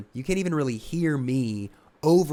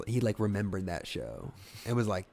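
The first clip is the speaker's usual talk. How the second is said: ordinary talk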